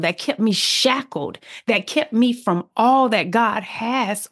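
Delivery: building preaching. Acted. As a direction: climb into testimony fervor.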